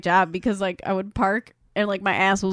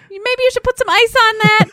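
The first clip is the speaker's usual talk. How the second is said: sheepish advice; 13 semitones higher, 8 dB louder than usual. Retold or imitated. Apologetic advice after punching.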